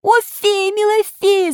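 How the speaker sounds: very high pleading puppet cry; desperate cry. A narrator's reading. Puppet begging fairy to save him, desperate cry. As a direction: call out high, begging for help. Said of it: "закричал поэт".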